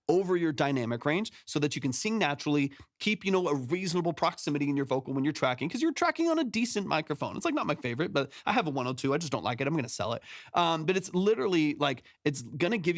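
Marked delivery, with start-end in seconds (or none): none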